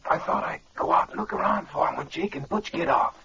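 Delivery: soft, hoarse head-cold voice; faked hoarse voice. Impostor passing as Jim's sick nephew, faked hoarse voice. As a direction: fake a sore throat, keeping it quiet. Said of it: "It's hard to talk hoarse like that".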